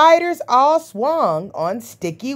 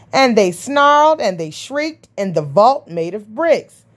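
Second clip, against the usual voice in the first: usual speaking voice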